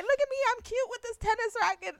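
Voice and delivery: high, preening cutesy voice; mock cutesy. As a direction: play the preening idol showing off. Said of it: "And they're like".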